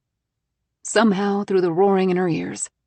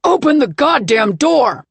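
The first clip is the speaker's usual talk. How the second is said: loud, high, slow furious yell; angry shout; 7 semitones higher, 10 dB louder than usual. Acted. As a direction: roar the order. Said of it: "Wes shouts".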